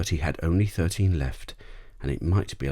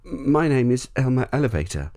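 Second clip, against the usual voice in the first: put-on boy's voice; 9 semitones higher, 5 dB louder than usual. A narrator's reading. Father answers the lion.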